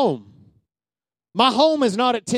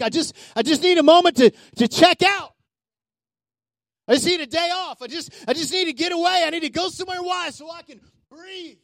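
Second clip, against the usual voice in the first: a lightly voiced worn-out churchgoer, higher and fading; weary imitation; 7 semitones higher than usual. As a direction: mimic someone begging for a break, a touch drained. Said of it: "Have you said that in the past week?".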